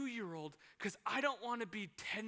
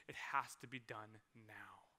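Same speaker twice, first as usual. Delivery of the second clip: low resolve; 10 semitones lower, 8 dB quieter, slower than usual. Real, not acted. Resolving to own his story.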